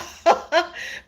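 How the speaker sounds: airy high-pitched laughter; breathy high laugh. Real, not acted. Still laughing at viewers, airy giggle. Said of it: "got me laughing loud".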